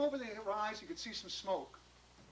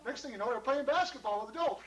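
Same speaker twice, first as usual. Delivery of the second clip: usual speaking voice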